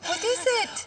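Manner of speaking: crying. Acted. Asking the sobbing visitor what's wrong, crying. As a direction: ask through tears.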